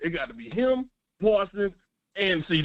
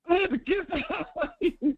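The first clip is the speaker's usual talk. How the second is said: laughing; 13 semitones higher, much slower than usual. Real, not acted. Caller laughs at missing game.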